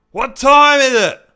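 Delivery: high, loud boozy voice; loud high drunk bloke asking; homeless fella asking. Retold or imitated. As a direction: call out the question like a tipsy old bloke. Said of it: "And I go / he".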